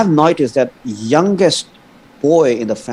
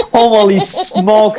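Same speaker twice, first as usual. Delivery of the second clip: stretched-out Holy smoke, laughing, higher; drawn-out exclamation; 8 semitones higher, 3 dB louder, much slower than usual. Real, not acted. Guest realizes his countrymen are watching, stretched-out laughing exclamation. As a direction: drag it out in laughing disbelief.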